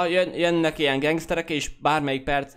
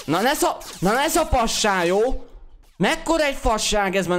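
annoyed outburst; 8 semitones higher, 3 dB louder than usual. Real, not acted. Annoyed at the game.

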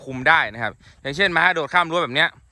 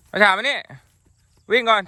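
high, raised calls to an animal; short urging shouts, pitched up; calling out commands; 6 semitones higher, 4 dB louder than usual. Real, not acted. Ordering his horse to come, run and jump, calling out.

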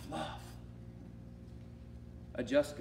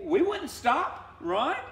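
mock defiant; 10 semitones higher, 5 dB louder, much slower than usual. Preacher mocking stubborn refusal.

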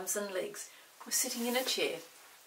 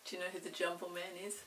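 plain reading voice

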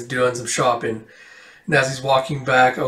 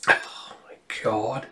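whisper; 3 semitones lower, 8 dB quieter, slower than usual. Real, not acted. GM aside to players.

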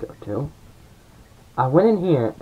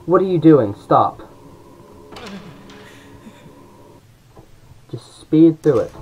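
frustrated yelling; 4 semitones higher, 5 dB louder than usual. Real, not acted. Yelling at character to hurry.

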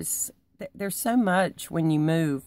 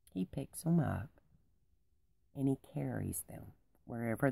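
soft tender telling; 4 semitones lower, 8 dB quieter than usual. Real, not acted. Tender shepherd lesson, voice drops.

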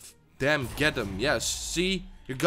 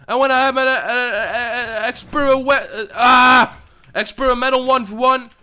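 whiny half-cry; 6 semitones higher, 6 dB louder than usual. Real, not acted. Whiny rant about ranked.

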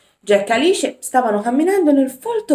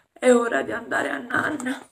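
sleepy yawn; 4 semitones higher than usual. Acted. Jack yawns and tells Alice it's bedtime, sleepy yawning. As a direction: say it through a yawn. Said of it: "fece un enorme sbadiglio".

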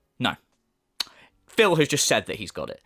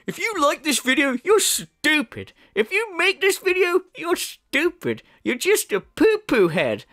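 mock him as a petulant child, pitched way up; exaggerated babyish taunting; childish mimicry; 10 semitones higher than usual. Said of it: "I love his childish mentality of".

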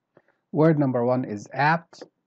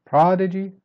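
"Prodigy" with loud high "prod"; strong start; 6 semitones higher, 7 dB louder than usual. Shown as a demonstration. Teacher modelling the word, "Prodigy" stressed up front. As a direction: hit the start of "Prodigy", then go down. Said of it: "Start strong".

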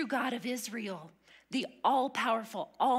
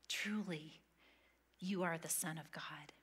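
softer, unvoiced whisper of awe; awed whisper; 4 semitones lower, 8 dB quieter than usual. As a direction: breathe it in reverent wonder. Disciples' confession repeated.

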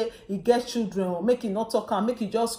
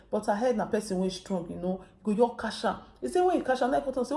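normal talking voice

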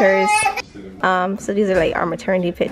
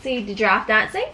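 put-on storybook voice for a little girl; reading to a child; 4 semitones higher than usual. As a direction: read it like a bedtime book. Reads picture book to child.

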